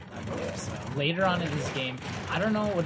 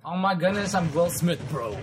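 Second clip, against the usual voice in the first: louder, cocky movie-star boast; Will Smith swagger; 4 semitones higher, 7 dB louder than usual. Pretend. Gamer boasting to viewers, mock Will Smith swagger. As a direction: puff up and brag like a movie hero. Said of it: "Gotta say it like that".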